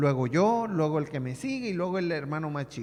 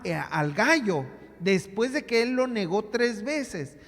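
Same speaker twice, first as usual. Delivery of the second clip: louder, insistent pulpit voice; preaching; 3 semitones higher, 3 dB louder than usual. Acted. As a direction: press the point home to the congregation. Preacher drives home the lesson. (predicación enfática y sonora)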